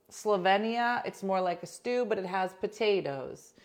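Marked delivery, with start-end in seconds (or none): none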